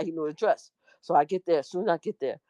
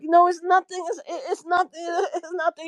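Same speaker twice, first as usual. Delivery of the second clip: high, nervous brushing off with a laugh; nervous denial; 11 semitones higher than usual. Retold or imitated. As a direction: deny it quickly and nervously. Scared girlfriend denying.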